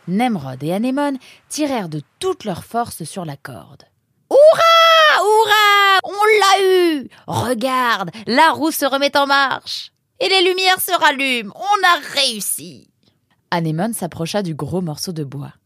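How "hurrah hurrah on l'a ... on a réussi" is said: very high, loud triumphant shouts; jubilant cheering. Acted. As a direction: whoop with joy, then exclaim in delight. Freed wheel, cheering success.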